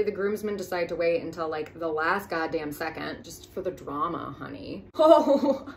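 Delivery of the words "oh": chuckling at her own pun; laughing. Real, not acted. Comedian laughing at her own joke, laughing. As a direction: laugh through the quip.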